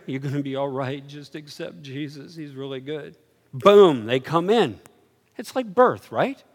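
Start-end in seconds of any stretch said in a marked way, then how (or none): 0.1-3.1 soft murmur in the ear; murmuring in their ear. Retold or imitated. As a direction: reassure him quietly, close to the ear. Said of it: "go in their ear".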